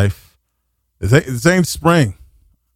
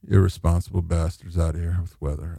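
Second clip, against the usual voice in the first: grumbled aside; 8 semitones lower, 9 dB quieter than usual. Real, not acted. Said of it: "too much screaming anyway".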